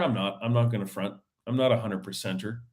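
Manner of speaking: ordinary talk, nothing marked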